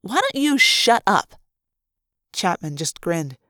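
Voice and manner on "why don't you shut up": loud, higher, slow angry snap; furious, drawn-out shut up; glaring retort. Acted. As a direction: bite his head off. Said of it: "Lauren glared at him".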